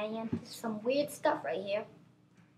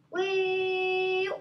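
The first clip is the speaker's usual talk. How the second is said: long, high, held "wee"; loud high-pitched playful wheee; gleeful wee; 7 semitones higher, 5 dB louder than usual. Pretend. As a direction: squeal one sustained note as if gliding down. Gliding down on a parachute.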